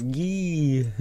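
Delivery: high silly yee; mock skeleton yell. Gamer mocking skeletons that can't aim. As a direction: squeal like a goofy skeleton. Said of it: "you're like".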